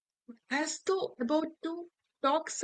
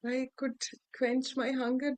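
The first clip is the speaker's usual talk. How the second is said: ordinary delivery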